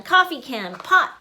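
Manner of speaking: plain reading voice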